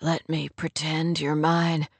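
low, soft, slowed plea; pleading male lead. A narrator's reading. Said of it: "he pleaded".

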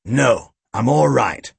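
emphatic, restraining refusal; firm police chief. A narrator's reading. Chief declining Boyle's violent offer, firmly. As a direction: call off the sidekick firmly.

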